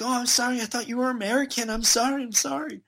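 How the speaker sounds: high-pitched imitation of apologetic teenagers; mock flustered, repeated apologizing; hurried apology. Startled teenagers apologizing to Lewis.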